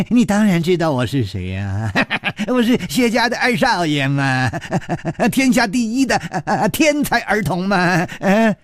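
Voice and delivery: high, giggling boast; feigned madman boasts to Chu Liuxiang, giggling. Acted. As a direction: laugh between every phrase. Madman laughing voice.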